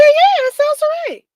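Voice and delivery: indignant insistence. Retold or imitated. Insisting to caller on phone.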